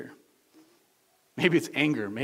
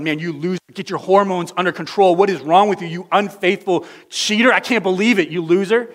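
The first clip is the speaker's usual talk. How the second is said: loud, raised, contemptuous berating; scornful angry God; 5 semitones higher, 11 dB louder than usual. Acted. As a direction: tear into them in disgust. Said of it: "do you hear the voice of God?".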